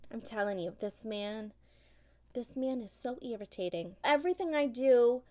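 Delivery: ordinary talk, nothing marked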